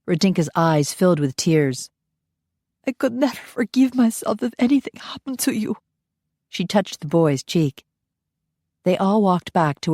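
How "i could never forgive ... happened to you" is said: tearful. Acted. Nanny to the boy, tearful. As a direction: hold back tears.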